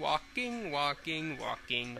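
a soft, murmured chant; quiet repeated walking. Pretend. Player mocks the silent walk, murmuring softly. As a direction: mutter it under your breath, step by step. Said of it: "Where's the talking?".

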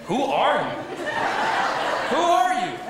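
mock-baffled, climbing; imitating a lost spouse, hammed up; inner bewildered cry. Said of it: "and you think".

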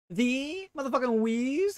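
hint of a high, slow Wheezy voice; light impression of Wheezy. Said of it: "Wheezy impression".

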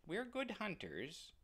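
high, soft kitten voice; tiny proud kitten; little kitten. Acted. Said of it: "said the kitten".

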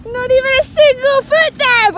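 shouting with triumph; excited shout. Real, not acted. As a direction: yell it out in triumph. Triumphant after clean ride.

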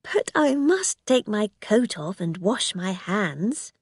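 plain reading voice

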